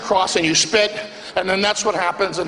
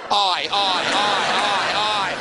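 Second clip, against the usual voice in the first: drawn-out Greek "aye aye" lament; dismayed groan; slower than usual. Acted. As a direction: moan at the insult. Reacting to weight comment.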